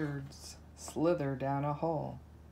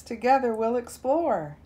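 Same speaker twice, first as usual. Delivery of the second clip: higher, cheerful cubs' call; roaring together; 6 semitones higher, 4 dB louder than usual. Acted. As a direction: promise the adventure with joy. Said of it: "roar the lion cubs".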